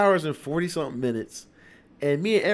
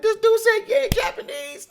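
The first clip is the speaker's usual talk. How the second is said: high, loud, disbelieving delight; amazed outburst; 6 dB louder than usual. Retold or imitated. Reacting at the movies to one word in Japanese, high amazed outburst. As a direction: squeal it in disbelief.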